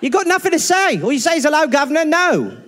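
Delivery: high hammed-up Cockney scolding; mock Cockney. Mocking the co-host's fake English accent in Cockney. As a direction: tell him off like a cheeky Londoner. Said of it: "You're not English, mate".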